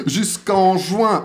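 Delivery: very slow, every syllable of "Jusqu'en juin" spelled out; slow careful pronunciation. Shown as a demonstration. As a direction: say "Jusqu'en juin" slowly and deliberately. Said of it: "je prononce bien".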